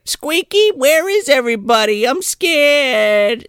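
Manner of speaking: put-on high kid calling out; girl alone in the cemetery; scared little girl. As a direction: call for a friend like a lost child. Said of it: "she's like".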